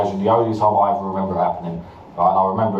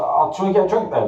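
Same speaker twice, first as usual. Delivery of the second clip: higher-pitched imitation of a woman; woman offering a drink; 10 semitones higher, faster than usual. Unwanted admirer suggests a drink, mimicked in a higher voice. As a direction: mimic her invitation up high. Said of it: "She's like".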